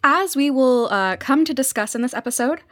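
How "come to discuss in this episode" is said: ordinary talk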